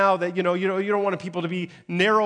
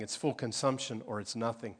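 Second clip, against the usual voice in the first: normal talking voice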